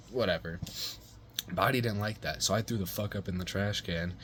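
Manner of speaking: ordinary talk, nothing marked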